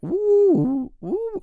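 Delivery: put-on high, wide-eyed oohs at the girl; mock awestruck ooh. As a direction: imitate a guy dazzled by a pretty girl. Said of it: "He's like".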